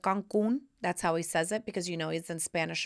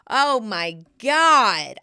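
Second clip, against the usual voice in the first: drawn-out disbelief; 9 dB louder, much slower than usual. Real, not acted. Mother turning seventy.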